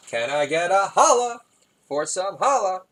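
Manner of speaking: high, drawn-out holler; hollered catchphrase. Acted. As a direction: belt out the catchphrase slowly. Hammed-up punchline call-out.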